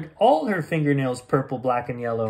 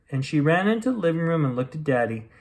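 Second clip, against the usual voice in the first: usual speaking voice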